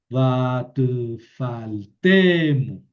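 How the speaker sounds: slow, loud Hebrew reading with the accent moved to the end of faltemo; shifted accent demo. Shown as a demonstration. Teacher reading Hebrew to the class, slow and loud. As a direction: stretch out faltemo and land on its last syllable. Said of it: "por eso movimos el acento hasta acá".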